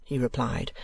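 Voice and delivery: ordinary delivery, nothing marked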